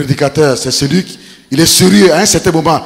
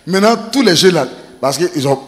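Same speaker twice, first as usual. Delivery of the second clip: ordinary delivery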